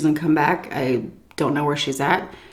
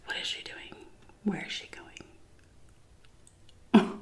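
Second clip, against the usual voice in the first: whisper; 16 dB quieter than usual. Retold or imitated. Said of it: "And I'm like".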